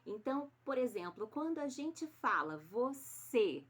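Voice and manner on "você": "você" slow, ending on a closed "ê". Shown as a demonstration. Teacher giving pupils the example, "você" with closed final E. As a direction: stretch "você" with the last vowel closed. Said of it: "com o E fechado".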